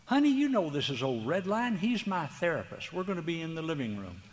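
a patient husband reassuring his wife; husband's voice. Retold or imitated. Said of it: "And he'd look at her and go".

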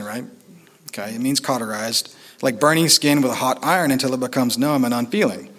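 usual speaking voice

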